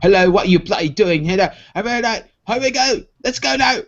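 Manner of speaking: high, screamed rough London punk voice; rough British punk accent. Retold or imitated. Imitating the punk band Crass in a screaming rough British accent. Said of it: "only know it from listening to the band Crass".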